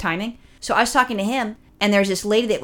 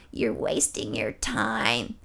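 much lower, slow, hushed eerie croak; creepy old lady; 8 semitones lower, 9 dB quieter, much slower than usual. Retold or imitated. Old lady repeats her warning to him, low and slow. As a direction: drop into a deep horror-movie whisper. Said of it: "kinda like a horror movie".

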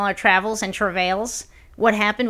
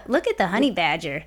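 honey badger narrator. Quoting the viral video.

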